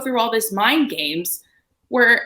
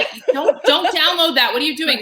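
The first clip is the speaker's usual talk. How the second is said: exasperated scolding; 8 semitones higher, 5 dB louder than usual. Retold or imitated. Daughter scolding dad in retold exchange.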